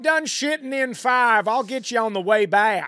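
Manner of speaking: high, very loud mock-mom yell; hollering mother. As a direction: holler at your kid like an impatient mother. Acting the mother hurrying her son.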